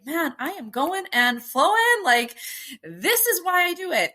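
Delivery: mock elation. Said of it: "you're like".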